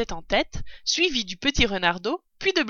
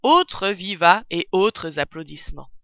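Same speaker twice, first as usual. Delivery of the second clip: ordinary delivery, nothing marked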